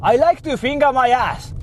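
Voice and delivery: hammed-up Japanese-accented English; heavy Japanese accent. Shown as a demonstration. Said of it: "heavy Japanese accent".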